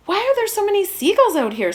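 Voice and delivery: a lightly voiced little girl, high and quick; curious girl. A narrator's reading. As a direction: ask it like a curious child on a boat. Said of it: "said Susan".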